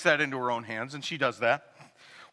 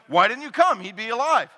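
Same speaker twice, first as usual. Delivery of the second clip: higher, louder accusing cry; mock yell; 5 semitones higher, 5 dB louder than usual. Martha yells at Jesus for coming late, mock yell. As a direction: accuse him like a hurt sister. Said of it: "She yells at him".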